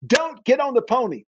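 put-on a voice cautioning the boy gravely; warning voice. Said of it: "he said it twice".

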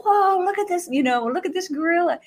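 put-on high, thrilled child pointing it out; excited children. Children in the stands spotting the gorilla, thrilled. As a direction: call out in delight like a kid.

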